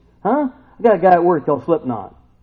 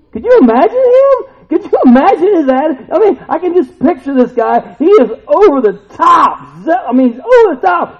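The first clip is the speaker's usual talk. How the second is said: much higher and louder, nearly shouting; full-force preaching; 14 semitones higher, 11 dB louder than usual. Acted. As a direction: preach with rising fire.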